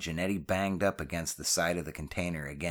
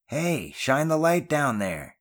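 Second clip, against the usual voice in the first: high, slow call upward; shouting up; 9 semitones higher, 4 dB louder, slower than usual. A narrator's reading. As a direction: call up to a friend, drawing it out. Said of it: "Janetti shouted up while pointing down".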